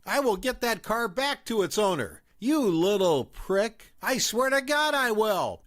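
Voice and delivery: high, mock shout at the narrator. A narrator's reading. Mom shouting back at the narrator on her way out. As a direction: yell a parting threat while walking off. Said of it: "she shouts at me".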